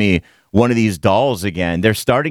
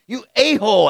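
mock yell, pitched up high; retold yell; 9 semitones higher than usual. Radio host repeating what he yelled at a stranger, mock yell. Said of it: "I'm yelling at people now".

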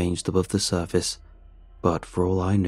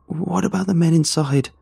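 alarmed woman; 9 semitones higher, 3 dB louder than usual. Acted. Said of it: "she asked the question".

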